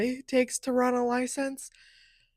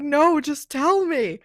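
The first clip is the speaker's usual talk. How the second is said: pleading, flustered; 7 semitones higher, 7 dB louder, slower than usual. Retold or imitated. Scared driver begs officer.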